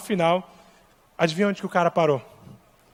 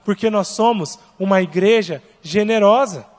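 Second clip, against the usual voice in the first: fiery preaching; 4 semitones higher than usual. Acted. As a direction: preach it with rising fire. Preaching on generosity.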